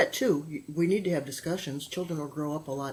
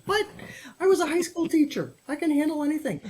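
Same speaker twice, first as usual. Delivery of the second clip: high, loud, mock-offended protest; mock indignant boast; 10 semitones higher, 5 dB louder than usual. Real, not acted. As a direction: puff up, playfully affronted.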